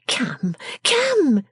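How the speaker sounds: high, brisk-minded character voice; businesslike scolding. A narrator's reading. Miss Wren chiding the wretched figure, high and businesslike. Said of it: "in a businesslike manner".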